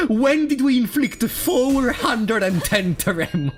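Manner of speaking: high, slow, laughing disbelief; incredulous. Real, not acted. Streamer spotting a huge number, incredulous. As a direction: stretch it out in amazed, laughing disbelief.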